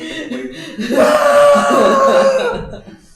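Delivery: long, high, loud scream; drawn-out high-pitched yell; mock scream. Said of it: "started screaming as I".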